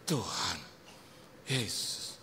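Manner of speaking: low, soft sigh to Jesus; helpless appeal. Retold or imitated. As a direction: breathe a resigned little prayer.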